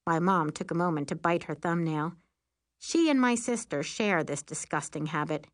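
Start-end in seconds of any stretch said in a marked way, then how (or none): none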